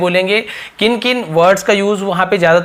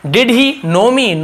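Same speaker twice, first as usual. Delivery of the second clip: drawn out, a bit higher; slow model question; 5 semitones higher, much slower than usual. Shown as a demonstration. Teacher slowly modelling a question for students. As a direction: say it slowly for learners.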